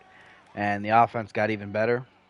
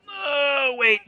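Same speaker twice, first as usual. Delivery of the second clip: alarmed cry; 13 semitones higher than usual. Real, not acted. Cord about to be crushed.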